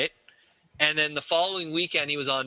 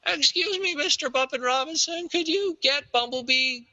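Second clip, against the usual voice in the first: much higher, overeager fan question; mock eager fan; 5 semitones higher than usual. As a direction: pester him like a starry-eyed fan. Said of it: "Now he's doing panels".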